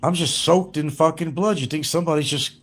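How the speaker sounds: upset protest. Retold or imitated. Retelling telling her off about blood.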